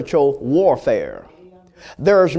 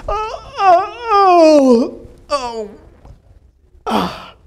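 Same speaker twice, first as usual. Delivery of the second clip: sleepy waking groan; 12 semitones higher, 3 dB louder, much slower than usual. Acted. Elijah woken from sleep.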